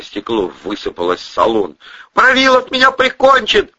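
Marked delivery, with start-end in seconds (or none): 2.1-3.7 high, loud wail; panicked, tearful high lament; nearly crying. A narrator's reading. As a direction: wail as if about to burst into tears. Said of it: "едва не заплакал Андрей".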